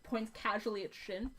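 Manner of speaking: ordinary talk, nothing marked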